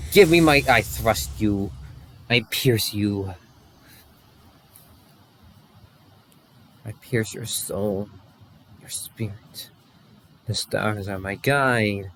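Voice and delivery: low, slow, fading to very soft; slow, deep warrior intonation, trailing away; dragoon's battle lines. Acted. Warrior reciting battle lines "I pierce your soul", low and slow.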